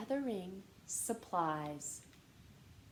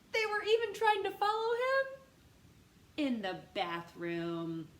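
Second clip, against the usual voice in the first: exasperated; 9 semitones higher, 5 dB louder than usual. A narrator's reading. Fed-up narration of brothers.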